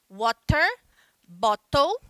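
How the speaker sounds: water, bottle with a crisp clear T; British English T demo; British pronunciation. Shown as a demonstration. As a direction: say the T sharply, British style. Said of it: "o inglês britânico, eles vão pronunciar".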